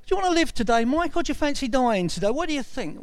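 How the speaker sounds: high, animated, teasing questions; mock questioning. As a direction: put an absurdly obvious choice to him. Mock self-questioning after laughing.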